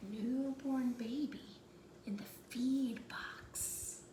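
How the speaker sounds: soft, slow whisper; hushed whisper. A narrator's reading. Narrator reaching the newborn in the manger, whispered. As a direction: tell it as if not to wake the baby.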